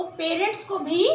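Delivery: ordinary talk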